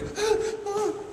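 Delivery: high, quick mock gasp of fright; imitated scared gasp. Skinny girl startled by a big guy, mock scared gasp. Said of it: "got scared".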